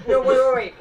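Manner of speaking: loud sudden wait, wait; excited shout. Real, not acted. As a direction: blurt it out, hold on. Sudden realisation about the flavour.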